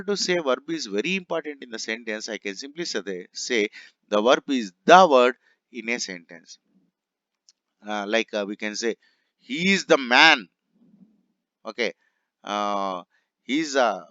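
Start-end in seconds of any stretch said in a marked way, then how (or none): 4.1-6.6 "the" punched loud as "dhaa" before "word"; stress on THE. Shown as a demonstration. Teacher simplifying "very important", stressed "the". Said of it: "with emphasis to give a force". 9.4-10.5 "the man" said higher and louder; emphatic praise example. Shown as a demonstration. Teacher showing praise of masculinity, emphatic "the man". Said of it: "some kind of emphasis has to be given".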